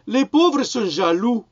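high, loud, slow sneering parody; mock him with a haughty drawl; hammed-up imitation of a smug minister; mocking quote. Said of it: "et qui ose prétendre".

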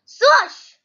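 loud, high voiced swoosh; swoosh sound. A narrator's reading. Narrator sounds the hook swinging at the duck, loud swoosh. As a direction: burst out a sudden swoosh effect.

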